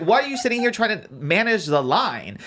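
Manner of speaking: incredulous scolding, replayed for a friend; retold going off. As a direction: re-enact challenging a bossy stranger. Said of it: "I was still going off".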